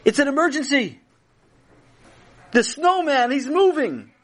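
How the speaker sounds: high-pitched alarmed cry; calling loudly. A narrator's reading. Chaim alerting Tati to moving snowman, calling loudly. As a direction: sound the alarm, voice way up. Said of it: "Chaim called loudly".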